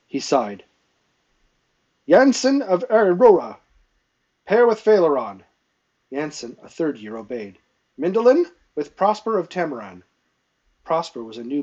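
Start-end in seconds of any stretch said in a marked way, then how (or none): 2.1-5.4 high, loud announcement; calling out orders. A narrator's reading. Training master reassigning pages into pairs, calling out orders. 8.0-10.0 raised, louder announcement; calling out orders. A narrator's reading. Training master pairing pages on practice court, calling out orders.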